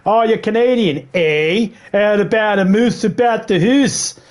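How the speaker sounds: imitating a bad Canadian accent; mock Canadian accent. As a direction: tease like a cheesy tourist doing Canada. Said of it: "I've heard people do that".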